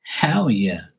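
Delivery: ordinary delivery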